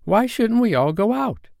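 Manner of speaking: put-on high, eager boy's proposal; excited cry. A narrator's reading. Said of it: "cried John".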